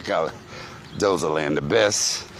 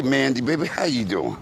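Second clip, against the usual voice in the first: usual speaking voice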